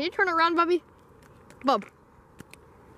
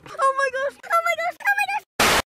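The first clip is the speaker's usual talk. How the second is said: rising panic. Acted. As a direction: voice climbing higher and higher in fright. Sees a person in dark.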